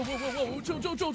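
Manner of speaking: ordinary talk, nothing marked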